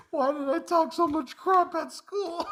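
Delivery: put-on high, loud, drawn-out kid's wail; mock crying. Dad imitates his son regretting his trash talk, mock crying. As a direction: imitate a sobbing child's regret, laughing through it. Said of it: "He's crying".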